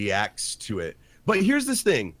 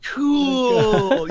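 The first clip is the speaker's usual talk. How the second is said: high, loud, laughing "Cool" like it's no big deal; mock the kids' carefree shrug; mocking the kids' unbothered reaction to murder, laughing; nonchalant kid; 12 semitones higher, 8 dB louder than usual. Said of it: "are like".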